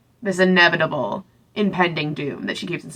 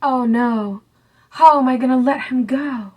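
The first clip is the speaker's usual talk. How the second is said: higher, hammed-up lovesick whine; mock despair; 6 semitones higher than usual. Celine dreading leaving Hayes, hammed-up lovesick whine. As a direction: play the melodramatic heroine. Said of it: "Celine is already like".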